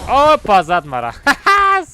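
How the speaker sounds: high burst of laughter; laughing shout. Real, not acted. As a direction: yelp then laugh it off. Got hit in game, laughing.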